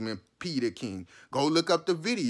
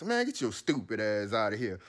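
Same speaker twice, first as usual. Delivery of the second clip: ordinary delivery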